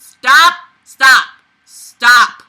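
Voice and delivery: loud repeated shout of stop. Real, not acted. Exasperated reader yells at the book to stop. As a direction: yell at the story to stop.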